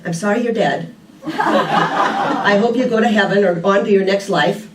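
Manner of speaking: quoting while breaking into real laughter; can't hold back a laugh while telling it; laughing through it. Real, not acted. Retelling grandson's ladybug blessing.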